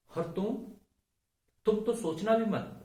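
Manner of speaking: high, loud warning to stay out of it; retold outburst, pitch and volume jumping up; emphatic dismissal. Son telling his mother not to even think of it, emphatic dismissal.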